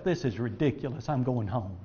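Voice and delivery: a hint of sulking Naaman; muttered grumble. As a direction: grumble it under your breath. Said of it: "he says to himself".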